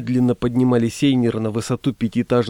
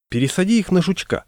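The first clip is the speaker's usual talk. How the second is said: dispatcher; 7 semitones higher than usual. A narrator's reading. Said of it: "ответила диспетчер".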